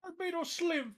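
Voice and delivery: high, put-on Caribbean voice; cartoon character voice. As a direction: say the name like the cartoon limbo champ. Futurama character voices trade.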